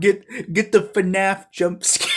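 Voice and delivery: loud, playful heckle; mock shout. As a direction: shout it as a hyped-up joke. Joking shout over played clip.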